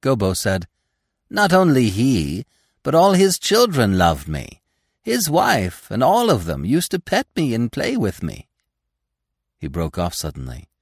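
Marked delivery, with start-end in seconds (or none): 1.3-8.5 put-on young deer voice. Gobo boasting.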